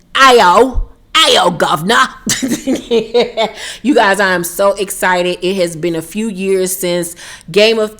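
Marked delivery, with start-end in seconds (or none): 0.1-2.1 a loud, jokey bad London accent on one last shout; mock London accent. Vlogger signing off her joke in a loud London accent. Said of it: "my horrible London accent". 2.1-3.8 a quick real laugh at her own bit. Real, not acted. Vlogger laughing after her mock accent.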